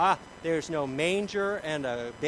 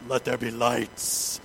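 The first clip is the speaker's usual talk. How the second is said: mock divine command; 4 semitones lower, 4 dB louder, much slower than usual. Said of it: "I'm like, "Hey, kids, look".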